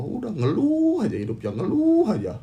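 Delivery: high mocking singsong. Host mocks anxious viewers for complaining, high mocking tone. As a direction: tease them in a high voice for always complaining.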